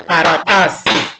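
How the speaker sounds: Karatasi with a long ta and short ends; correct pronunciation. Shown as a demonstration. Teacher repeats the right pronunciation for learners. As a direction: lean on ta in Karatasi. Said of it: "We say karatasi".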